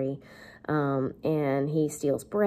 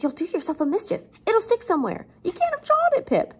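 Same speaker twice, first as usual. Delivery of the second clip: high, alarmed put-on voice climbing higher; Joe freaking out; 12 semitones higher than usual. Teacher voices Joe fretting over Pip's eating, high and alarmed. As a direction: pitch soaring in mock worry. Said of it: "he starts freaking out".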